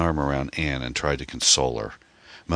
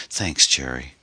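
usual speaking voice

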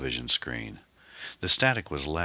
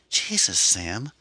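she bursts out the moment he opens up; exclaiming; 11 semitones higher than usual. A narrator's reading. Shelley soaked at Sam's door, exclaiming in relief. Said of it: "she exclaimed".

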